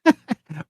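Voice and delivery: high put-on chuckle; short high character laugh; farmer's mocking laugh. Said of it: "he starts laughing".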